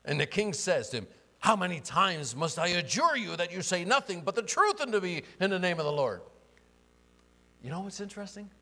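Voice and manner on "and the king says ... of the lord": loud, high royal outburst; exasperated king, dramatic reading. Acted. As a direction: demand the truth at full volume. Reads king's exasperated reply aloud.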